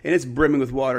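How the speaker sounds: ordinary talk, nothing marked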